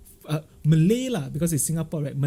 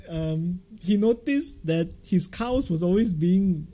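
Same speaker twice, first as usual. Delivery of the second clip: usual speaking voice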